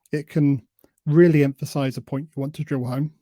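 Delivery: ordinary talk, nothing marked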